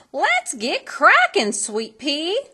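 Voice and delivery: fairy godmother shouting to Cindy; shouted. A narrator's reading. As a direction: holler it out rousingly. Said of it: "shouted her fairy godmother".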